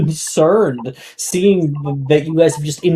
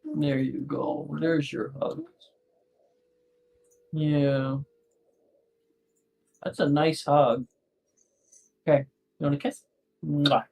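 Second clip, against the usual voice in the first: tender bedtime voice; 11 dB quieter than usual. Real, not acted. Parent hugging a child goodnight, soft and tender.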